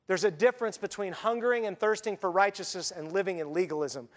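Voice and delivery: normal talking voice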